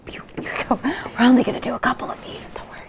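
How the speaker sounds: a whisper meant to carry; stage whisper. Acted. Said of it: "This is my stage whisper".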